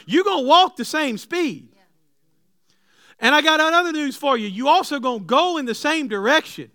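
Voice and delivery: loud, high preaching push; preaching at full force. Acted. Preacher booming at the church about yokes. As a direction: drive the point home to the congregation.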